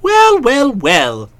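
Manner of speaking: rising then falling "well"; amused surprise. Acted. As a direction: savour the news.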